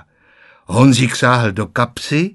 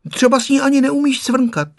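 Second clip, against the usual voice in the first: put-on teasing child; 13 semitones higher, 3 dB louder than usual. A narrator's reading. Boy teasing grandma.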